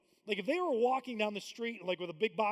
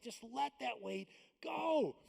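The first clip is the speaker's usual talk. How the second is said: gentle urging; 5 semitones higher, slower than usual. Retold or imitated. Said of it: "he's like".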